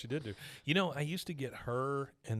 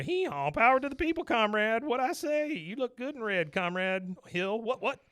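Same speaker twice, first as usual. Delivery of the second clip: much higher braying cartoon voice; donkey puppet voice; 9 semitones higher than usual. Donkey puppet teasing Hank as comrade, high braying voice.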